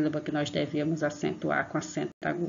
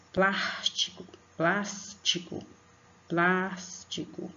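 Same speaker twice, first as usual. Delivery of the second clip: "plástico" said slowly with "plás" stressed; slow syllable stress; slower than usual. Shown as a demonstration. Teacher slowly repeats "plástico" to show the stressed "plás". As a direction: drag out "plástico", leaning on "plás". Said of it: "É a sílaba plas".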